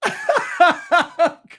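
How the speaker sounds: loud high-pitched laugh; shrieking laugh; high burst of laughter. Real, not acted. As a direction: crack up at the question. Reaction to crude question.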